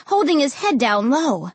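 ordinary delivery

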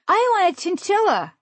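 put-on high little-kid voice with a lisp; toddler lisp. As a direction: lisp it like a tiny child. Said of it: "said with a slight toddler lisp".

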